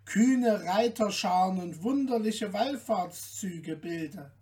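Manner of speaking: ordinary delivery, nothing marked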